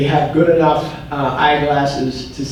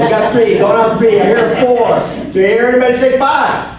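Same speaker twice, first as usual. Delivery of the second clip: higher, louder auction call; auctioneer voice; 6 semitones higher, 4 dB louder, faster than usual. Pretend. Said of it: "I feel like an auctioneer".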